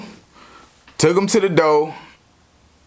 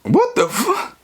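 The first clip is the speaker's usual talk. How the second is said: shocked; 12 semitones higher than usual. Retold or imitated. Catching them in the act.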